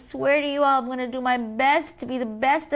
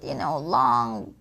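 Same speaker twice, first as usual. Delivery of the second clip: ordinary delivery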